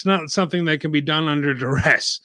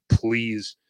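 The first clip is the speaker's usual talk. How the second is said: sarcastic; 5 semitones lower, 4 dB quieter than usual. Acted. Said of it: "That's sarcasm".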